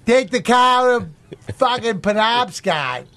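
slow, drawn-out Boston accent. Shown as a demonstration. Showing the others his practised Boston accent, drawn-out Boston accent. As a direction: lay on a broad Boston accent. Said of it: "Boston accent a little bit more".

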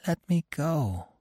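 high, soft plea; despondent, pleading softly; soft, beseeching. A narrator's reading. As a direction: weakly beg in a small, high voice. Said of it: "He spoke softly and beseechingly".

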